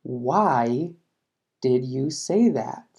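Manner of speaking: slow, careful question for learners; slow classroom model with a falling question tune; slow teaching read. Shown as a demonstration. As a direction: spell it out slowly, falling at the end. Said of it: "Por ejemplo".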